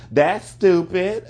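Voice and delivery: amused, drawn-out put-down; laughing. Real, not acted. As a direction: giggle it out slowly as a mocking verdict.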